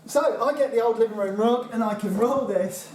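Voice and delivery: usual speaking voice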